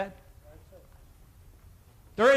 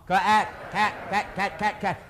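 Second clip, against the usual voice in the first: "cat" blended quicker and quicker; faster repetition; slower than usual. Shown as a demonstration. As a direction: speed up "cat" into one quick word. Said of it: "say it a little bit faster".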